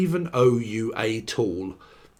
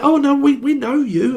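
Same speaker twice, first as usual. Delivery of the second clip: high, excited mock fan voice; gushing fans; 12 semitones higher, 4 dB louder than usual. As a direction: insist like adoring strangers. Fans insisting they know him.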